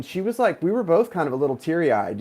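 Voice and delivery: normal talking voice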